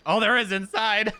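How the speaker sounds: high and loud, laughing; laughing repeat. Real, not acted.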